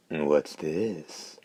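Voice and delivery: hoarse, mildly intrigued question. Acted. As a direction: rasp it with curiosity. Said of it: "the hoarse voice exclaimed, sounding intrigued".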